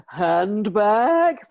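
high, loud, booming Lady Bracknell outrage; Lady Bracknell impression. The storyteller imitating [name] mimicking Lady Bracknell, grand booming outrage. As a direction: boom it out like a grand dowager. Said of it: "he would do things like Dame Edna".

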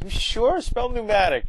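put-on high teasing girl's voice; mock Lisa. Lisa challenging Bart before the bee, in a high mock voice. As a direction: lift it high, testing him smugly.

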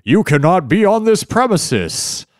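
high, loud, stiff robot voice. Retold or imitated. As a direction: bark it like a stiff guard robot. Imitating the guard robot.

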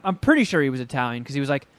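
normal talking voice

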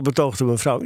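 plain reading voice

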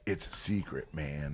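mocking a brewer who keeps secrets; secretive brewer. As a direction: lean in like guarding a recipe. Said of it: "brewers who are like".

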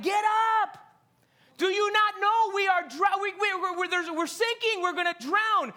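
high, loud frantic wake-up call; panicked plea. Retold or imitated. Disciples waking Jesus in the storm, panicked. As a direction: shake him awake in panic.

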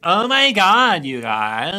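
mock Valley Girl, high and loud at first; slow, hammed-up Valley Girl accent. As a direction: caricature an American Valley Girl, vowels drawn out. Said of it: "what they think Americans all sound like".

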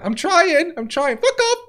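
very high, straining "I'm trying"; mock strained plea. Woman tells comic she is trying to hold it in, high strain. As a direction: squeak it out like you're holding it in. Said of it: "She went".

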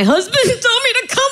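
breaking into high laughter mid-quote; laughing. Real, not acted. Retelling her first therapy visit to the host, laughing. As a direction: giggle through the line.

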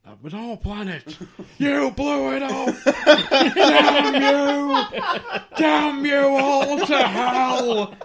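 cartoon outburst building to a high, loud wail; hammed-up despairing shout, drawn out; Homer. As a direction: mimic a cartoon dad's horrified meltdown. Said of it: "Homer when he goes to".

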